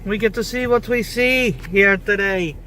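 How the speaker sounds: loud, hammed-up Irish brogue; mock Irish accent. Showing off a loud mock Irish accent to the stream. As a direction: put on a jokey Irish accent for the viewers. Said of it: "enjoy my lovely Irish accent".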